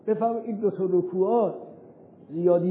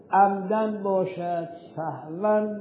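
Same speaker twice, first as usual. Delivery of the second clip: slow, deliberate; much slower than usual. Shown as a demonstration. Teacher spells out legal terms slowly.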